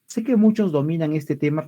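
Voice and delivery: usual speaking voice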